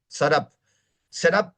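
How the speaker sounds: "setup" said "serap", T flapped; "setup" the American way, "sa-rap"; rounded T. Shown as a demonstration. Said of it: "El redondeo de la letra".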